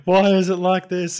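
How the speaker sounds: high, loud, slow mock wail; mock lament. Host reacting to the meme with a mock wail. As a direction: moan it to the heavens.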